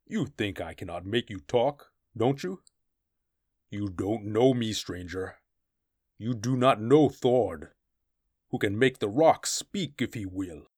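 rough, swelling boast; boastful barbarian brute. Acted. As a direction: threaten him, proud of your cruelty.

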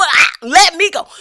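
high, loud pleading dog voice; choked dog voice. Retold or imitated. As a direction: beg as the choking dog. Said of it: "He doing".

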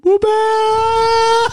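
squealed high greeting; a bright high cheer to open the show; excited. Real, not acted.

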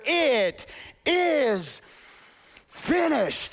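victor's shout; triumphant battle cry. Acted. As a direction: declare victory. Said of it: "a shockwave went through the cosmos".

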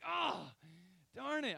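sudden high, loud "ah, darn it"; frustrated outburst. Retold or imitated. Drywall screw punches through the wall, frustrated outcry. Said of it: "I'm frustrated".

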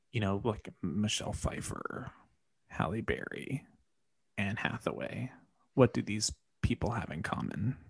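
soft whisper dropping the names; secretive whisper of the hint. Real, not acted.

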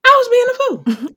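high, loud self-mocking declaration; emphatic. Real, not acted. To the host about her past self, a loud high admission. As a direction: call herself out, loud and high. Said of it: "And I was being a fool".

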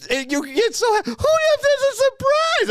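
very high, loud, drawn-out gushing; hammed-up falsetto of a doting pet owner; mock dog cooing. Mocking friend discovering dog mess, exaggerated high coo.

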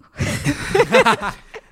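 high loud gloating laugh; villainous laugh. Acted. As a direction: cackle over her disgust. Officer laughs at insult.

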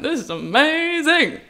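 high, slow, thrilled gush; dreamy delight, drawn out. Retold or imitated. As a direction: savour how amazing it is. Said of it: "I was like".

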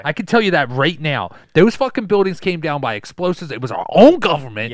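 loud, insistent crank explaining his evidence; punchy, worked-up conspiracy rant; conspiracy theorist. Acted. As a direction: rant like a proud New York truther. Plays ranting conspiracy theorist.

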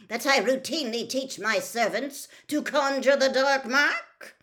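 loud, high, indignant cold fury; cold anger. A narrator's reading. Mister Crouch challenging the accusation against him, coldly angry. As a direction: build to an outraged question. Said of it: "cold anger in every syllable".

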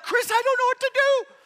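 high, loud helpless cry; panicked plea. Retold or imitated. To his friend Chris at the cliff edge, a high panicked plea. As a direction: cry out that you're out of options.

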